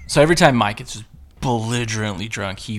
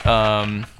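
ordinary delivery

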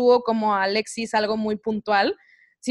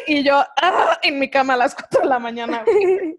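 laughing; 4 semitones higher, 3 dB louder than usual. Retold or imitated. Retelling panic, laughing.

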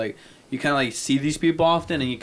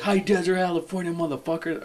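mock frontman shout; 5 semitones higher than usual. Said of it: "Does he like".

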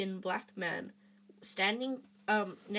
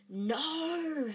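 sharp, higher hissed refusal; 7 semitones higher than usual. A narrator's reading. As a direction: snap it back under your breath. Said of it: "Harry hissed".

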